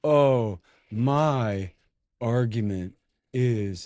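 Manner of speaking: slow, drawn-out mock British professor; lightly voiced slow pompous professor; mocking slow talk. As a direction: drag each word out to run the clock. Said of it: "he talks so slow".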